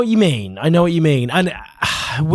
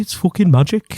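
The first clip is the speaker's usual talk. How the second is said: Midlands accent attempt; 5 semitones higher than usual. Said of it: "not Midlands".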